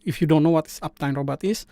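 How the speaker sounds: normal talking voice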